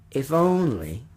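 tentative, left hanging on a rise; wistful hesitation. Acted. Old man hinting to the mother, hesitant and unfinished. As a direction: break off hopefully before the request.

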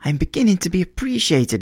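high, quick burst of delight; delighted cry. A narrator's reading. Young playwright finds the sapphire, delighted cry. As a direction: exclaim it, pitched well up. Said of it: "he cried".

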